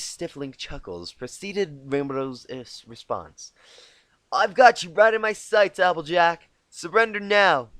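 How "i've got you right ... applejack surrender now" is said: cocky taunt. Acted. Rainbow taunts from behind wall.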